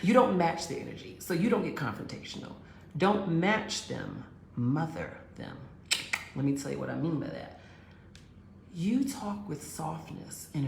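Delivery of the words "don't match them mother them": slow, stressed "mother"; slow emphatic advice. Shown as a demonstration. She teaches women her rule, slow and emphatic. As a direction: drop each word as a lesson. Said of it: "delivering her philosophy slowly".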